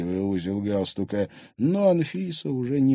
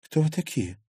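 higher, bewildered question; taken aback; 7 semitones higher than usual. Acted. Girl questioning unknown visitors, taken aback. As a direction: ask them in puzzled surprise. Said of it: "Лиза слегка опешила".

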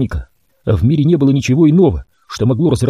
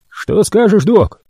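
raised rough mutant voice; Royal asking; 9 semitones higher, slower than usual. A narrator's reading. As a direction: voice the brute mutant, higher. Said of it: "спросил Роял".

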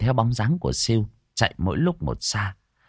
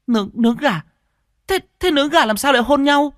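high, loud accusing shriek; Siêu, yelling angrily; 5 dB louder than usual. A narrator's reading. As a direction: throw the excuse back, stammering with rage. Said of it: "Siêu lại càng quát to hơn". (quát to, the thé)

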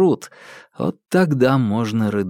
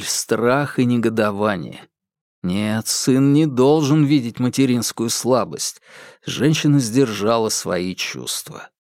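ordinary delivery, nothing marked

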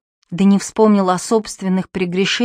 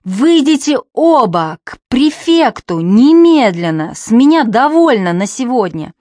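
louder, slow exasperated order; 4 semitones higher, 5 dB louder than usual. A narrator's reading. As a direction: lose patience, order them out. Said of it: "выведенный из себя".

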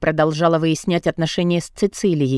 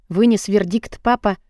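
plain reading voice

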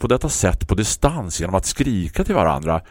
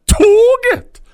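much higher, louder baffled yell; confused shout out the window; 9 dB louder than usual. Acted. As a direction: bellow the word in disbelief. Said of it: "skrek jag".

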